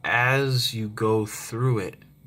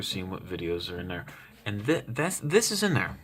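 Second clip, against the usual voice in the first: ordinary talk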